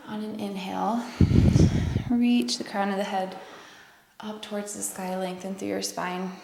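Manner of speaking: calm, guiding yoga cues; yoga instructor. Acted. Yoga instructor leading the final breaths.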